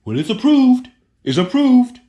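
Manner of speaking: high, loud mock voice of gullible people; mocking naive believers. Mocking people who trust the FDA, high loud parody. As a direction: ham up the blind faith, high and loud. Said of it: "that's what our people do".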